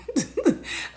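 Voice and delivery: a quick high burst of laughter. Real, not acted. Vlogger laughing at her tease about Elijah, a quick high giggle. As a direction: giggle at your own joke.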